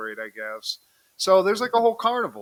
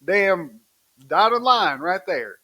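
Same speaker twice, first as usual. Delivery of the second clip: judge voice, loud; 5 semitones higher, 7 dB louder than usual. Retold or imitated. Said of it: "He's like".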